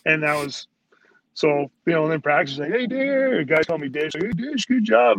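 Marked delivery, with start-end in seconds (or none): 2.7-3.4 high, upbeat greeting, imitated; mock-cheery high call; all positive. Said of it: "he was all positive". 4.2-5.2 high-pitched mock-cheery praise; chirpy, imitated pat on the back; all positive. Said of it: "he was all positive".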